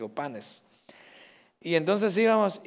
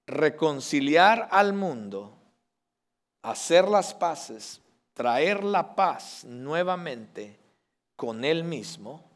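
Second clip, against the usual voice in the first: slow emphasis; slower than usual. Real, not acted. Preacher stresses the message slowly.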